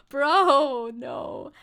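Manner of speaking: shouted disbelieving bro; sudden loud bro. Real, not acted. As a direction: blurt it out loud at chat. Shouted reaction to chat.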